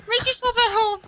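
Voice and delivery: put-on very high-pitched decoy girl. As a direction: chirp it high, calling from inside. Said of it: "and she's like".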